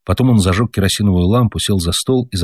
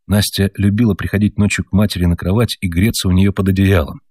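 ordinary delivery

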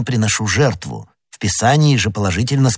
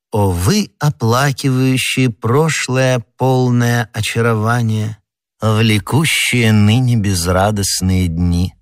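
pious poet reciting his rondeau; poem recitation; 2 semitones higher than usual. A narrator's reading.

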